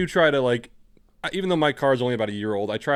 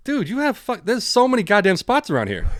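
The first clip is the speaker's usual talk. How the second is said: much higher, louder mock outrage; exasperated inner rant; 10 semitones higher, 5 dB louder than usual. Venting at honking driver in his head, exasperated. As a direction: retell a silent rant, high and indignant. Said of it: "in my head, I was like".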